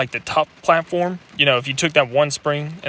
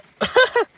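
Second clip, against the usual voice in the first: short high giggle; brief leftover burst of laughter; real laughter. Real, not acted. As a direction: let a high chuckle slip out. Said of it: "I'm sorry for laughing".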